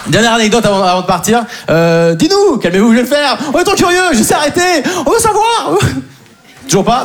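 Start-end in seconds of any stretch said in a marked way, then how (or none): 2.2-5.8 higher, rising eager crowd voice; climbing excited crowd; mock eager audience. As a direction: play the impatient audience begging. Voicing the audience's pleas.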